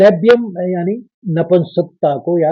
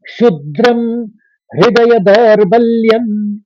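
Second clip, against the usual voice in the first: shloka recitation; 4 semitones higher, 4 dB louder than usual. Shown as a demonstration. Teacher reciting the third and fourth lines together. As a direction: chant the second half of the verse. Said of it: "अब तीसरे और चौथे के सुनिए".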